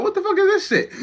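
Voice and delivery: high, laughing outburst in stunned disbelief; shocked disbelief. Pretend. Imagined reaction to a spirit gone the wrong way, high laughing shriek. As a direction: squeal it out like you can't believe your eyes. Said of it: "you'd be like".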